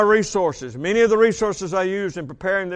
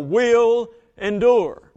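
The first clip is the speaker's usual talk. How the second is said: "will endure" drawn out very slowly, both words leaned on; preacher underscores "will endure"; stress on will endure; 5 semitones higher, 5 dB louder, much slower than usual. Shown as a demonstration.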